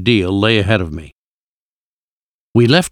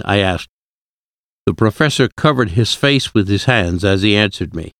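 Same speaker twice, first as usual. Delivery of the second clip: ordinary delivery, nothing marked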